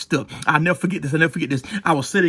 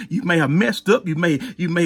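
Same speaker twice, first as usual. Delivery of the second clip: usual speaking voice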